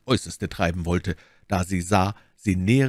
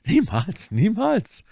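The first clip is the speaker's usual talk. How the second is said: emphatic repeated denial, rising higher; convincingly sincere; 12 semitones higher, 3 dB louder, slower than usual. A narrator's reading. Milady denies the affair to Bonacieux, emphatically sincere. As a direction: swear it, leaving no doubt. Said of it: "in einem Tone, der an der Wahrheit".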